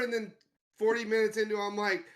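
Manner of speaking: normal talking voice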